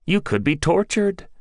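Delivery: plain reading voice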